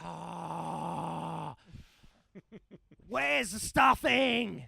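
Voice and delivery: put-on slow, loud, high drunken yell; drunken shout. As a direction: bellow it like a wasted mate. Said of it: "He's like".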